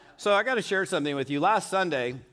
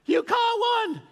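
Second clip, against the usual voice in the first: delighted shriek; slower than usual. Retold or imitated. Wife seeing her husband with the fish, squealing in surprise.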